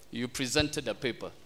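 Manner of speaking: ordinary delivery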